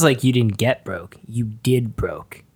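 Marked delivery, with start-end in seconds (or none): 1.3-2.4 hurt whisper. Acted. Hurt reply to betrayal.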